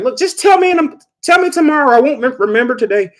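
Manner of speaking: high, fast, brushing him off; imitating a drowsy wife rushing him; impatient sleepy wife. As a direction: hurry him off the phone. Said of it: "she's like".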